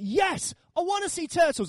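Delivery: put-on high, childlike burst of enthusiasm; hammed-up eager yes; eager excitement. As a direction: act out jumping at the offer. Acting out his own eager reaction.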